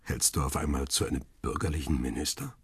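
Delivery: low voice of the husband; husband's retort. Acted. Husband's dialogue.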